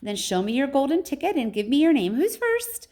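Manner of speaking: high, loud calling-out; piping high showman, rising at the end; high and fluty. A narrator's reading. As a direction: call out instructions in a high, fluty voice. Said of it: "His voice was high and fluty".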